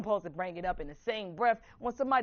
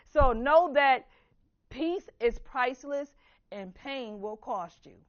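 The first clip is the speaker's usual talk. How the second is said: slow emphatic quote; slower than usual. Shown as a demonstration. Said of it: "Did you hear that?".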